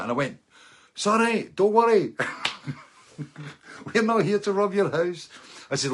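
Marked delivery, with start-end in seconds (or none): 0.9-5.3 high, loud called-out reassurance; acted apology, pitched way up and loud; overdone apology. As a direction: shout comic reassurance to a nervous stranger. Hailing a nervous homeowner, retold.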